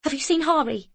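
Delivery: rising panic, calling out high; panicked calling. A narrator's reading. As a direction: call for the boy in growing panic. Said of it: "Her voice now had a tone of panic in it".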